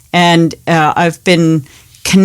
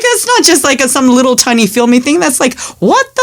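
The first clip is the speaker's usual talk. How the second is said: much higher, astonished outburst; excited disbelief; 5 semitones higher than usual. Retold or imitated. Mom retelling seeing the Lego movie credits, excitedly high. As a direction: blurt it out high, almost squeaking in amazement. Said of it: "I'm like".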